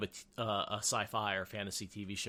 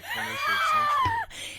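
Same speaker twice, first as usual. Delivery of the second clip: high-pitched yelp; high shout; 10 dB louder, slower than usual. Real, not acted. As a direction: shriek it out loud. Mock outburst about embarrassing download.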